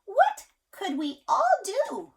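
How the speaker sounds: high, slow little rabbit voice; a small wondering bunny, drawn out. A narrator's reading. Parent reading to child, a high slow rabbit voice. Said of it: "said the rabbit".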